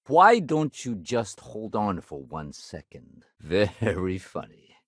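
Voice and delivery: slow, drawn-out sarcastic retort. Acted. Officer answering a joke about holding on, sarcastic. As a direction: drag it out with dry mock amusement.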